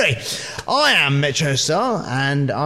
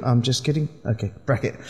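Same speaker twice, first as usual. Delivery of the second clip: normal talking voice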